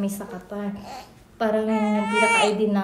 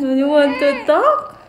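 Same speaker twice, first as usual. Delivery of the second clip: high, very slow, louder sing-song coo; 5 semitones higher, 8 dB louder, much slower than usual. Real, not acted. Mother coaxes her baby to talk, in a slow, high, loud coo.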